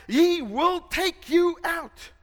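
loud, high fiery preaching; preaching at full force. Real, not acted. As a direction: thunder the warning to the congregation.

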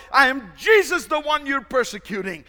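loud, high proclaiming voice; dramatized Jesus. Acted. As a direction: announce yourself with authority. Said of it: "dramatize it".

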